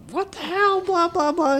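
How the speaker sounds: very high squeaky mock complaint; high mocking whine; imitating annoyed girlfriend. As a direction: parody her griping in a tiny high voice. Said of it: "She's like".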